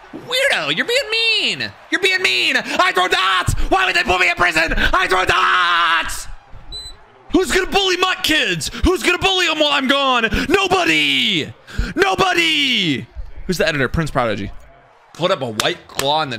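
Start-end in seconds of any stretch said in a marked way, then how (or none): 0.1-2.6 mock whiny voice. Mock whiny voice teasing chat. 2.6-6.3 much higher, whining mock voice, shouting the last line; high whiny put-on voice. 7.3-13.1 high mock brag.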